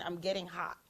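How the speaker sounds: usual speaking voice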